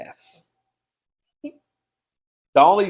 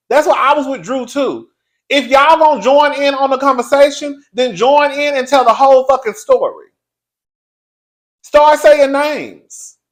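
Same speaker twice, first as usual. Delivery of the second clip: higher, loud exasperated tirade; heated rant; 9 semitones higher, 8 dB louder than usual. Real, not acted. Telling off castmates for half-telling the story, heated. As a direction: build from pointed complaint to raised-voice outburst.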